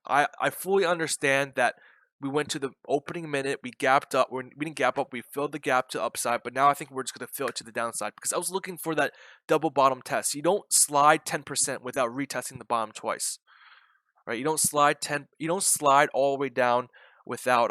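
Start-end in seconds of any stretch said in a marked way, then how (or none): none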